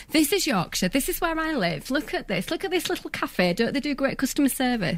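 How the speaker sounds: beaming stage pitch for Yorkshire; proud sales pitch. Retold or imitated. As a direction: sell the county like a tour guide.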